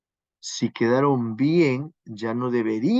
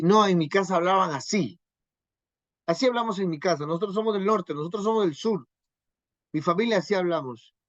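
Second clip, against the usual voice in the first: higher-pitched, insistent excuse-making; mock defensive; 5 semitones higher than usual. Husband justifying how his family talks, mock defensive. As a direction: protest in a lightly acted, higher voice.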